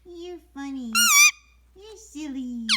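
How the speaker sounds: ordinary delivery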